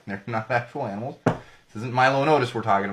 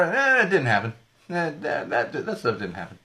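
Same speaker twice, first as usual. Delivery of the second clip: dismissive shrug; 3 semitones higher than usual. Retold or imitated. Imitating studio dismissing reboots.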